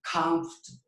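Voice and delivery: Comfortable with COM stressed hard, rest reduced; slow exaggerated stress. Shown as a demonstration. Teacher drills Comfortable slowly and exaggerated. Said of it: "hacerlo muy lento, pero muy exageradamente".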